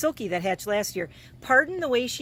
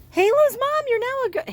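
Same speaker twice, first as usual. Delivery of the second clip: excited squeak; 5 dB louder than usual. Real, not acted. Excited about three generations.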